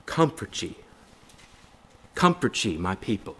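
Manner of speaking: soft, gentle consoling read; softly comforting scripture; tender comfort. Shown as a demonstration. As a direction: hush it like comforting a grieving friend. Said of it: "what kind of voice was it".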